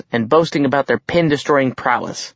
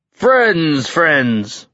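higher, drawn-out greeting to a crowd; hearty hail; 4 semitones higher than usual. A narrator's reading. Giant king greeting his guests, hearty hail. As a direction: hail the room like a grand host.

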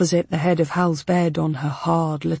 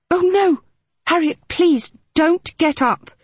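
high, louder cry of alarm; 10 semitones higher, 4 dB louder than usual. Acted. Mitzi urging Hal to stay in bed, alarmed. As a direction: rush to stop her getting up.

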